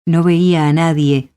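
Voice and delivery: ordinary delivery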